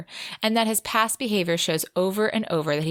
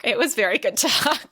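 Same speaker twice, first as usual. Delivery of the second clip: higher, gushing delight; giddy agreement; 5 semitones higher, 3 dB louder than usual. Real, not acted. Co-host agreeing about the steamy talk, giddy. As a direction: gush it, on the edge of laughter.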